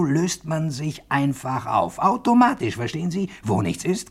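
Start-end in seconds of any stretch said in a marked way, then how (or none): 2.0-2.8 automatisch flung out high and loud; emphatic boast. Acted. Proud inventor to his listener, high loud emphatic boast. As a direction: hammer home Automatisch with a proud cry.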